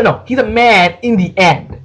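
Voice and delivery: ordinary delivery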